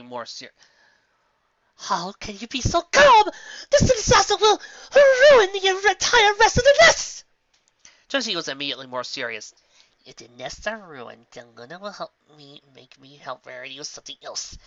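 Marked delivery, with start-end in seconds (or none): 1.8-7.2 high, loud crying wail; distraught wail. Acted. Rarity distraught. 10.0-14.6 thinking aloud quietly. Acted. Trixie's inner thought.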